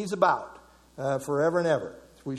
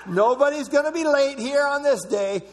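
laughing; 7 semitones higher, 4 dB louder than usual. Real, not acted. Joking about all on time.

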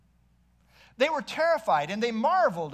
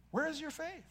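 "Where is your faith?" higher and soft, "where" lifted; higher, pleading ask; 6 semitones higher, 11 dB quieter than usual. Shown as a demonstration. Preacher repeating Jesus's question, higher and softer. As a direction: lift the question up, softly urgent.

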